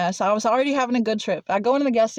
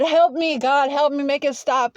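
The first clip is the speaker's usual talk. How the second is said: retold cry for help, pitched up high; desperate pleading; 5 semitones higher than usual. Praying to God as bugs crawl out, desperate pleading. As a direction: plead up high for it to stop, lightly acted.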